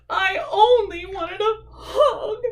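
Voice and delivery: high, slow pouty whimper; sniffling. Acted. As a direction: whine it like a rejected little bear. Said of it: "sniffed Douglas".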